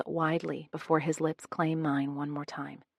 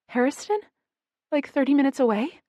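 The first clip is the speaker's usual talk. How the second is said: higher, louder, startled question; surprised; 8 semitones higher, 5 dB louder, faster than usual. A narrator's reading. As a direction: pop up in disbelief. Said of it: "my eyes wide with surprise".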